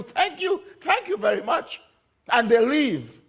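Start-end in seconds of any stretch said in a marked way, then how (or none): 0.0-1.8 high-pitched, grateful voice; effusive thanks. Acted. Two forgiven debtors to the banker, acted gratefully in a high voice. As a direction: pipe up high with repeated thanks.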